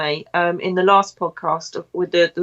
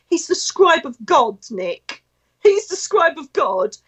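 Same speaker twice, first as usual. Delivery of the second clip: astonished explanation; 7 semitones higher than usual. Retold or imitated. Acting friend's astonished explanation.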